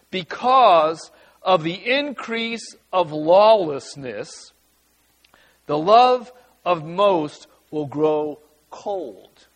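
slow, weighty reading of the verse; scripture reading. Acted. Pastor reading the words of Jesus to the church. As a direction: let each word land.

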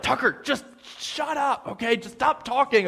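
high, hushed imitation of a fed-up friend; exasperated pleading. Terrified seatmate begging her teasing friend to stop, exasperated.

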